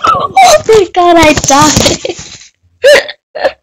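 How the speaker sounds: high, loud shriek dropping fast; startled shriek. Real, not acted. Player just died in the game, startled shriek. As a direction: scream at being suddenly killed.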